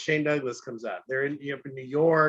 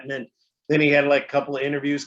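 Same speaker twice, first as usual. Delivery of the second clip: ordinary delivery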